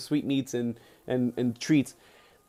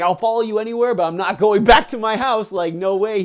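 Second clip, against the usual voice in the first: louder, raised-pitch acted protest; emphatic refusal; 5 semitones higher, 6 dB louder than usual. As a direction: dig in as the stubborn disciple. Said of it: "he refuses".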